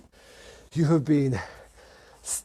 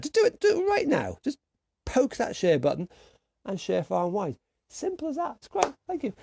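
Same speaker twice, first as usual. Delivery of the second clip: squeaky pleading; 9 semitones higher than usual. Pretend. Begging viewers to press share.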